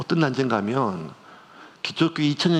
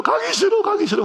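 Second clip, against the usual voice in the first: much higher, whimpering refusal; mock crying. Imagined son at the army gate, mock crying. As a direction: wail it like a child who won't go. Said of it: "막 울면서".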